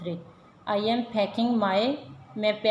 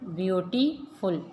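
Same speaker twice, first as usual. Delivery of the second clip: Beautiful slowly, as beauty, फुल; split into syllables; 2 semitones lower, slower than usual. Shown as a demonstration. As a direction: pause before फुल in beautiful. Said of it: "इनके syllable हिज्जे count".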